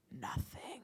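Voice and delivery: breathy whisper, trailing to nothing. Acted. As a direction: let the word die out in a whisper. Burnt-out emptiness.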